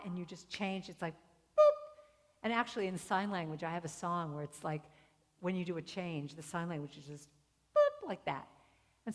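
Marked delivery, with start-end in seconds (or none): 1.1-1.8 high, loud little pop; playful "boop". Shown as a demonstration. Sound for sudden change. 7.8-8.1 quick high pop sound; playful "boop". Shown as a demonstration. Sound for sudden change.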